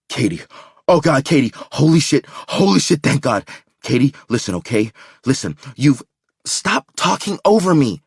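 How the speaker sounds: high, loud frantic relief. A narrator's reading. Man desperately reaching his girlfriend by phone, frantic. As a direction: blurt it all out at once.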